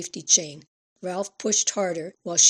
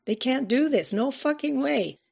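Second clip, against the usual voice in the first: angry protest; 7 semitones higher than usual. A narrator's reading. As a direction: refuse it outright, voice rising. Daughter protests custody.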